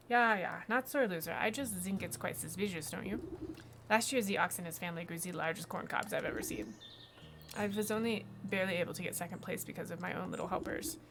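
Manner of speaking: put-on defensive farmer with a German accent. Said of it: "I have no idea why it's a German accent".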